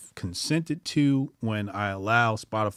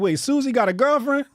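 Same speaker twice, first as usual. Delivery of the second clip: surprised; 13 semitones higher, 7 dB louder than usual. Retold or imitated. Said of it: "And I was like".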